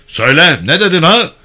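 mock interrogation shout. Gendarme faking a harsh interrogation for listeners outside. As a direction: yell as if beating a prisoner. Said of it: "Birden bağırdı".

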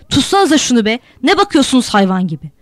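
ringing angry shout. A narrator's reading. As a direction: order them to grab her. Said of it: "Malik'in sesi çınladı birden". (öfkeyle çınlayan bağırış)

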